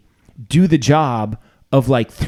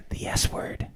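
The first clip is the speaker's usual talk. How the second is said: whisper; 11 dB quieter, slower than usual. Real, not acted. Censoring a cuss word on air.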